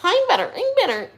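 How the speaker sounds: very high, squeaky repeated nagging; annoying housemate. Retold or imitated. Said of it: "that's so annoying".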